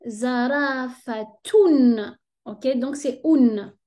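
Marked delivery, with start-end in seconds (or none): 0.1-2.2 zarafatun drawn out very slowly, ending in a clear tun. Shown as a demonstration. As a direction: stretch zarafatun with a falling close. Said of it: "Et là, c'est".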